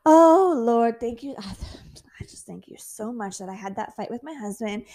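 fake-grateful prayer; sarcastic thanks to God after a fight with husband; mock gushing gratitude. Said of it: "It's not an instant reaction for me to be like".

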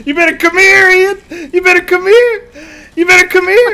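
high, loud hunting taunt; 1 high monster shout. Pretend. Monster chasing Ian down, shouting high and loud.

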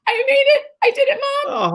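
high, choked-up cry to mom; overcome, squeaking through tears; tearful triumph. Retold or imitated. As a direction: voice breaking with proud tears. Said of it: "And I was like".

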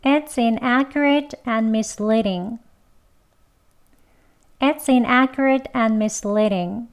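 very slow, careful learner model; slowly. Shown as a demonstration. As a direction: say each word slowly and clearly. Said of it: "천천히 두 번".

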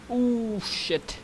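high startled "ooh"; surprised. Real, not acted. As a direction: caught off guard. Bad reaction time result.

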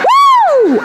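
high loud celebratory woo; whoop. Pretend. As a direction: let out a cheering woo. Whooping about Val's strut.

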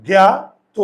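জ্ঞাত said loud, ending in an o sound; correct pronunciation. Shown as a demonstration. Teacher to class, modelling জ্ঞাত with o ending, loud. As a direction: stress জ্ঞাত, end on o not a. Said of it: "আমরা ত বলি".

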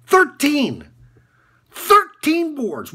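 high, punched number, stretched out on the repeat; worked-up, high-pitched emphasis; emphatic announcement. Acted. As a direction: hammer it home, high and drawn out. Coach ranting about his player's numbers.

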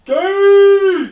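high, very loud drawn-out jeer; loud mocking heckle; heckling shout. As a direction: yell it out long and high. Heckles the girl's presentation.

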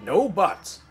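stern. A narrator's reading. Railway boss overrules the twins, stern. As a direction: shut down the protest sharply.

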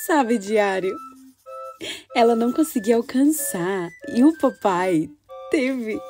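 put-on gentle storytelling for children; story narration. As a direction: read it like a child's diary. Reads girl's diary story.

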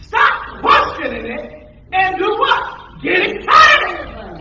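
loud, high, drawn-out exhortation; real full-force preacher's shout, stretched slow; excited preaching shout. Real, not acted. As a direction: shout it out to rouse the congregation. Preacher rousing congregation to excitement.

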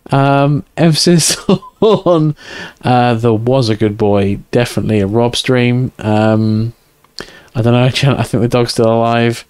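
normal talking voice

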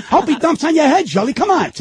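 high, indignant jab at Jilly; indignant retort. Retold or imitated. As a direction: throw it back at him, up high.

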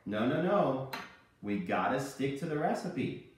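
ordinary delivery

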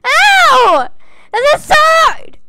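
loud, high yelp of pain. Real, not acted. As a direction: cry out as if struck. Hit by a sword in game.

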